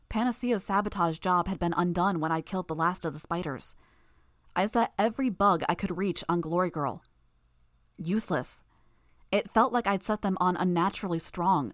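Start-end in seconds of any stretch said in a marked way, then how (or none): none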